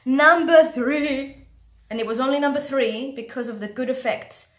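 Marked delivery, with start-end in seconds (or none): 0.0-1.4 drawn-out announcement. Real, not acted. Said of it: "countdown announcement".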